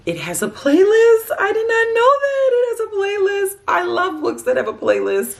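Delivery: gasp, then high, loud delighted squeal; real burst of excitement at a happy discovery; excited surprise. Real, not acted. Just discovers the book's playlist.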